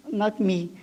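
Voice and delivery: normal talking voice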